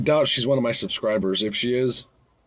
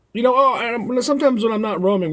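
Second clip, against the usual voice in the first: ordinary talk, nothing marked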